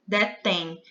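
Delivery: "Detém" with the final M sounding like a nasal I. Shown as a demonstration. Teacher showing how "Detém" really sounds. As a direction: say "Detém" ending in a nasal vowel, as if the M were an I. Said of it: "é como se esse M fosse um I".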